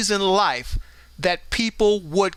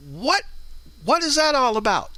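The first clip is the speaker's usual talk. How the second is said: shocked disbelief; 7 semitones higher than usual. Retold or imitated. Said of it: "And I'm like".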